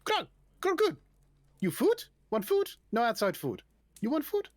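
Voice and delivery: high-pitched kobold barkeep; little creature hawking food in broken speech; expectant kobold. Acted. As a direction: pitch it high, short eager offers. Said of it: "looks at you, um, expectantly".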